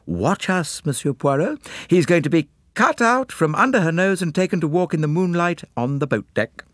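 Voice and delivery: put-on high, girlish confiding voice; conspiring young girl. A narrator's reading. Girl telling Poirot her plan, high conspiratorial voice. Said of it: "came up to Hercule Poirot and murmured".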